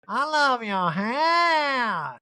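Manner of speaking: high, very drawn-out gushing; Erica. Retold or imitated. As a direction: coo it in a stretched mock voice. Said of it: "And Erica's like".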